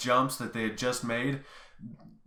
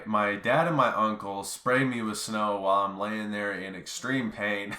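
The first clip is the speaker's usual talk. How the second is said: ordinary talk, nothing marked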